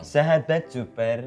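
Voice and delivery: small-voiced young motivator; soft, low-volume greeting like a barely grown motivator; Mario Teguh. Co-host tries the motivator greeting, small voice. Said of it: "Kecil suaranya".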